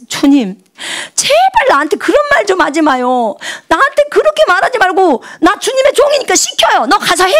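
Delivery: high, exasperated plea; a high, pleading complaint to God; pleading with the Lord. Retold or imitated. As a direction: beg him to just give the order.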